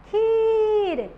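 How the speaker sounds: खीर with a slow held ee; long stretched ee. Shown as a demonstration. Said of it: "लंबा खींचना है". (खीर को लंबा खींचकर)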